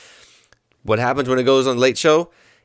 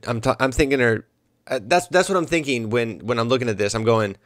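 ordinary delivery

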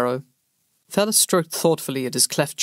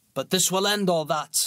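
scornful soldier; 4 semitones higher than usual. A narrator's reading. Bellis mocking the pauper prince, scornful soldier voice. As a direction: sneer at the rebels.

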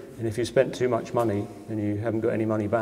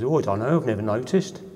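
put-on Birmingham accent; 2 semitones higher, 3 dB louder than usual. Local man interviewed in the square answers, in a mock Birmingham accent. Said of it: "and I will do the accent now".

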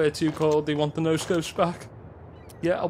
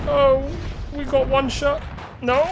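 much higher and louder, hyped call; excited shout; 12 semitones higher, 4 dB louder than usual. Real, not acted. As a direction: shout it as the last chance comes up. Last bullet in tense fight.

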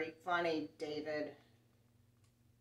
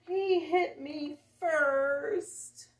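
much higher, louder, very slow whine; whiny tattling kid; 9 semitones higher, 9 dB louder, much slower than usual. Acted. Child complaining in quarrel.

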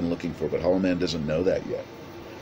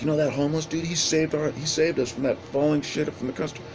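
higher, eager neighborhood gossip; gossiping neighbor; 9 semitones higher than usual. Retold or imitated. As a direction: pass on the news like a thrilled neighbor. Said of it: "she's like".

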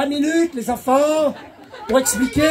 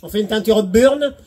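ordinary talk

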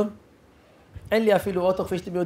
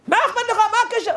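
much higher, louder disbelieving outburst; incredulous father; 3 dB louder, faster than usual. Retold or imitated. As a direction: sputter in disbelief at the offer. Father snapping.

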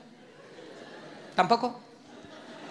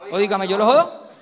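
louder, challenging wife; defiant wife; 5 dB louder than usual. Acted. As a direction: dare him to answer. Esposa desafiante al marido. (esposa retando al marido, más fuerte)

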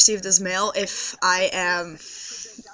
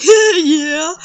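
put-on high, loud, giddy drunk "yeah"; drunken yeah; 6 semitones higher, 8 dB louder than usual. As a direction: blurt it out tipsy and giddy. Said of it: "I was like".

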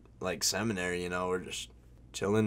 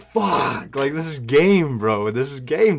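loud panic; 4 semitones higher, 8 dB louder than usual. Retold or imitated. Panicking about mom coming.

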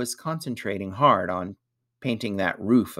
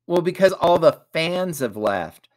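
answering the riddle; 8 semitones higher, 7 dB louder than usual. A narrator's reading.

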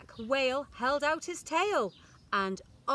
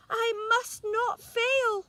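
high, loud determined snail; high and loud, set on not failing; tiny snail, determined; 12 semitones higher, 6 dB louder than usual. A narrator's reading. Snail setting off to help, high determined voice. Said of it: "said the tiny snail".

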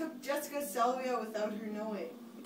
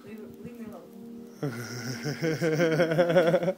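stoner; 3 semitones lower, 8 dB louder than usual. Real, not acted. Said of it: "I'm really high".

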